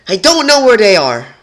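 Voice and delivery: very high, loud desperate yell; desperate shout. Acted. Twilight shouting her ignorance at the rebels, desperate yell. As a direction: yell "I don't know where they are!" in despair.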